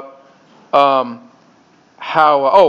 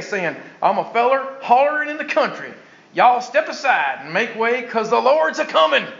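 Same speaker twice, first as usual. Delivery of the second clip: country hillbilly storyteller, pitched up; hillbilly accent; 3 semitones higher, 4 dB quieter than usual. Acted. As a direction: read it like a backwoods hillbilly telling Bible tales. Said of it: "That's the Hillbilly Bible".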